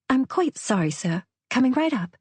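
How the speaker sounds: high, polite put-on English accent; English waitress voice, dropping on "sir"; fake English accent. A narrator's reading. Waitress apologising to impatient diners, fake English accent. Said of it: "Her accent was impeccable".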